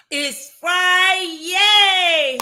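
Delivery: loud, high, drawn-out cheer; excited show opener. Real, not acted. Host greets her audience to open the show, excited cheer. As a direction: stretch the day's name into a big whoop.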